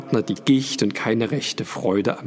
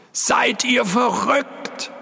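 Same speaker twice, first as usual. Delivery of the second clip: very high, stretched-out shout; outraged yell; 14 semitones higher, slower than usual. A narrator's reading. Porter shouting at the intruders, yelling. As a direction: yell it in disbelief. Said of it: "schrie der Pförtner".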